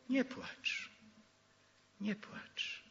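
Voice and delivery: gentle hush. Acted. Elder comforting weeping John.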